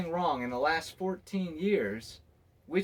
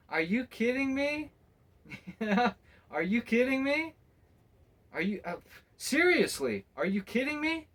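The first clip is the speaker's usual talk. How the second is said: higher, disbelieving protest; incredulous; 6 semitones higher than usual. Real, not acted. Disbelief at people freaking out over him, higher and incredulous. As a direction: pitch up in laughing disbelief.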